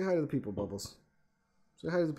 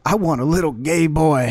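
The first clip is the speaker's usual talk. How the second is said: louder put-on voice, dragged like a fake overdub; mock dubbed line; 2 semitones higher, 6 dB louder than usual. Mocking the interview clip, a louder put-on voice. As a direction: deliver it like a cheesy fake dub. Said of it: "Cut to".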